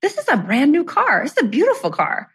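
high, incredulous pep talk to herself; sassy self-talk. Retold or imitated. Telling herself off in the scorching car, sassy and amused. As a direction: scold yourself, then hype the car up with a laugh.

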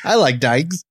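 lightly imitated Snatch movie accent; Brad Pitt. Podcaster quoting Snatch to co-hosts, mock film accent. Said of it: "talking like Brad Pitt and Snatch".

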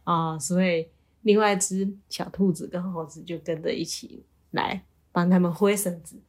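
plain reading voice

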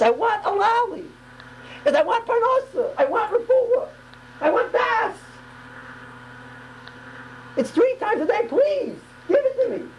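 very high, pleading wail; passionate cry. Shown as a demonstration. Preacher acting out a person begging in prayer, passionate cry. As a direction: cry out each demand from the depths. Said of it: "it's a cry from the depths".

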